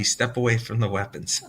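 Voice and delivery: reading a joke through real laughter; laughing. Real, not acted. Laughing as he reads a viewer's teasing comment. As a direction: chuckle the words out as you read them.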